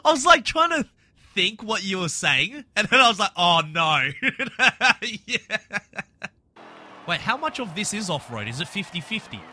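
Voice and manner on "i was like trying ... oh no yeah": giggling through the words; laughing. Real, not acted. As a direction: laugh while you talk. Laughing while explaining.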